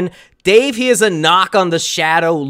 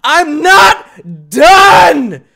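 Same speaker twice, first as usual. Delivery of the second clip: much higher, loud and very slow; slow drawn-out shout; 7 semitones higher, 7 dB louder, much slower than usual. Real, not acted. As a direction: stretch out the yell. Repeated shout.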